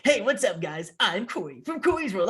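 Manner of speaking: hammed-up hey-guys vlogger voice; cheesy YouTuber. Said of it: "that stupid".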